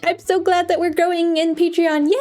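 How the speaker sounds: high-pitched, delighted gratitude; high, giddy and grateful; gushing thanks. Real, not acted. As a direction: squeal your thanks with glee. Thanking new Patreon members.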